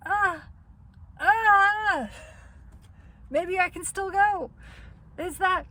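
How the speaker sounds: hammed-up desperation, grasping for a way out; mock panic. Panicked self realizing missed trip.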